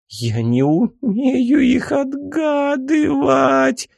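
put-on high, slow whiny girl voice; whining. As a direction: whine like a sulking girl. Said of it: "заныла девушка".

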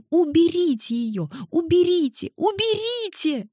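put-on very high child's squeal, climbing with each repeat; shrieking. A narrator's reading. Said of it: "верещала она".

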